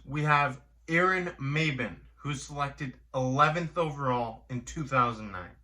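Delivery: ordinary delivery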